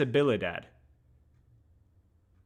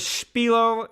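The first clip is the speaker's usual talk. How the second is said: imitating wife; 9 semitones higher, 5 dB louder than usual. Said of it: "She goes".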